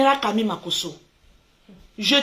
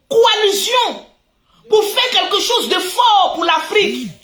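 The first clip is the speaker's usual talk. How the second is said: louder and higher as it builds; rising passionate plea; 8 semitones higher, 5 dB louder than usual. Real, not acted. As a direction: build from appeal to outcry. Building plea against infighting.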